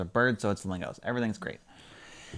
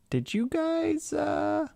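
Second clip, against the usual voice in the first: high voice; much slower than usual. A narrator's reading. Narrated character question.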